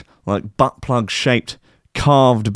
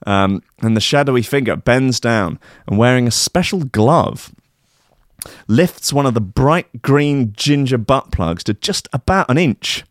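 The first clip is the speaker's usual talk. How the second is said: normal talking voice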